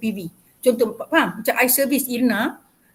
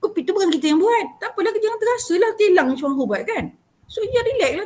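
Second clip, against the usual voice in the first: excited reassurance; 7 semitones higher, 4 dB louder than usual. Real, not acted. Urging calm, fast and high.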